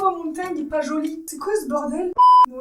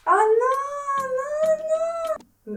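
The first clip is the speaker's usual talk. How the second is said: horrified protest; 11 semitones higher, slower than usual. Real, not acted. Vlogger seeing the highlighter picked, high horrified wail. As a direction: wail the refusal, pitched right up.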